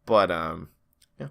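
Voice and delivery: ordinary talk, nothing marked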